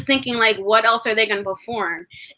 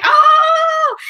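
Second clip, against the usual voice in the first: excited gasp; 7 dB louder than usual. Retold or imitated. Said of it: "we're like".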